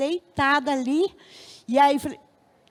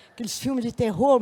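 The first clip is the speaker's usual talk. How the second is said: ordinary talk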